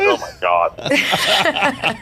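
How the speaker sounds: slow, high, breaking into laughter; laughing disbelief. Real, not acted. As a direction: draw it out through your own laugh. Laughing at his own story.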